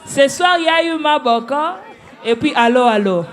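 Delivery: loud, high voice breaking into laughter; amused, loud announcement. Real, not acted. Judge teasing the duo with a nickname, loud and laughing. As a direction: call it out, on the edge of laughing.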